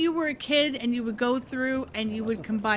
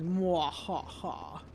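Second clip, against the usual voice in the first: sneering panto villain; 6 semitones lower, 5 dB quieter, much slower than usual. Pretend. Gloating while crafting signs in game.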